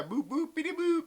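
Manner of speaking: high-pitched robot boops; playful high electronic-style beeps; droid beeps. Acted. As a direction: imitate a tipsy droid beeping. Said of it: "You sound kind of like a drunk droid".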